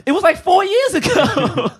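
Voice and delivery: high, slow laughing retort; high laughing mock protest. Real, not acted.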